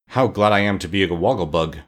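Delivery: ordinary delivery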